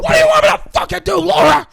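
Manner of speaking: very high, loud, fast hammed-up yell; mock furious shout. Father shouting at wife Laura, hammed-up furious yell. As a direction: explode at her.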